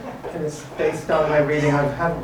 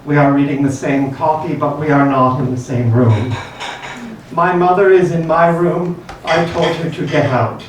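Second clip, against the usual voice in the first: louder, declaimed poem; poetry reading; 2 semitones higher, 7 dB louder than usual. Real, not acted. Poet reading a poem about mother, projected recital. Reads own poem aloud.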